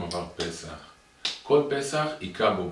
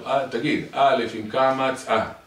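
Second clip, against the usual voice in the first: slow, loud, high sing-song chant; drawn-out alphabet recitation; repetitive drilling; 4 semitones higher, 7 dB louder, slower than usual. Acted. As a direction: repeat the letter lesson like a teacher to a child. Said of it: "הלאה, אתה חוזר".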